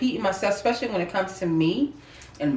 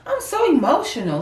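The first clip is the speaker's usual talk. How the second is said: emotional quote; 7 semitones higher than usual. Retold or imitated. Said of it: "text Nelly".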